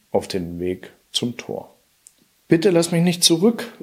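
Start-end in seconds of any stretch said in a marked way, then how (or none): 2.5-3.7 higher, pleading call of a trapped harp; a touch of desperation, begging not to be left; desperate plea. A narrator's reading. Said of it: "rief die Harfe verzweifelt".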